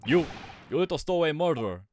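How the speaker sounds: put-on gruff Russian accent, loud and angry; Russian sailor shout. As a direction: bark at the stowaway in a Russian accent. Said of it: "I'm not sailor".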